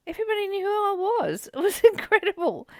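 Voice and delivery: high, quick, delighted laugh through the words. Real, not acted. Laughing with delight about her welcoming new neighbours. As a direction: bubble over with joy.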